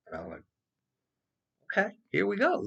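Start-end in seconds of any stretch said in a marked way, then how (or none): 1.7-2.7 gleeful thought. Retold or imitated. His gleeful retold thought.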